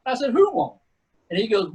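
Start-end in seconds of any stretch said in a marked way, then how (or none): 0.3-0.8 higher, louder "Who won't?" thrown back; cocky challenge. Retold or imitated. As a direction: snap back the dare like you mean it. Taking the pudding dare.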